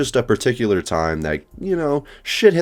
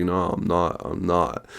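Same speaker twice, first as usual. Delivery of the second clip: a hint of a drowsy, nodding-off coworker, a bit softer; half-asleep denial; 4 dB quieter, slower than usual. Coworker nodding off insists she's fine, lightly imitated drowsy voice. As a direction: mildly imitate someone dozing off mid-denial. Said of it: "literally talking like that".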